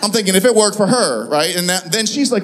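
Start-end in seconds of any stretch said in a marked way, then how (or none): none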